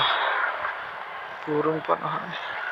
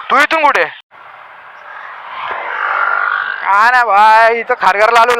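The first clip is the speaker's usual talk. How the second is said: loud over traffic; 4 semitones higher, 5 dB louder than usual. Real, not acted.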